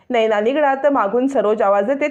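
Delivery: ordinary delivery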